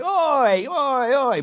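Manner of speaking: loud high Cockney shout of oi. Shown as a demonstration. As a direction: shout oi like a Cockney pub crowd. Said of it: "that Cockney knees up".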